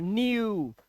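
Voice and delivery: न्यू said as "nyoo", slow and drawn out; yoo sound. Shown as a demonstration. Teacher showing the EW rule in न्यू, long yoo sound. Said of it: "तो ये यू की आवाज आ रही है".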